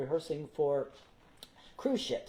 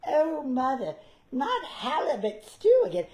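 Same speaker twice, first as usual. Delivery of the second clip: Tiny Tim complaining; 10 semitones higher, 6 dB louder than usual. Retold or imitated. Retelling his Tiny Tim line onstage, high whining child voice. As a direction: whine like a little boy sick of soup. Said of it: "I went".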